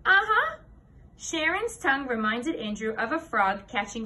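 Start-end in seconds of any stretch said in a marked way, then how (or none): 0.1-0.6 high, loud, knowing uh-huh; smug confirmation. A narrator's reading. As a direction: confirm it smugly, pitched way up. Sharon smugly confirms the secret.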